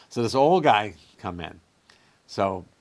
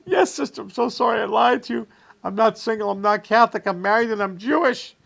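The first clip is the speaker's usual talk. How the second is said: high, loud, wailing confession; weeping cab driver; 13 semitones higher, 6 dB louder than usual. Acted. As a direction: sob it out in a high cry.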